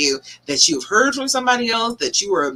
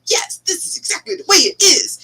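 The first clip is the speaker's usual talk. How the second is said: high, emphatic certainty; super sure; 10 semitones higher than usual. Acted. The inner voice declaring its verdict, super sure. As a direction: insist it with total conviction. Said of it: "That voice is super sure inside your head".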